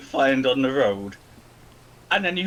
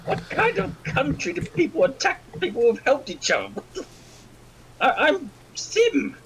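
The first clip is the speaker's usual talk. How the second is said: character voice; 11 semitones higher than usual. Acted. New character speaking in voice.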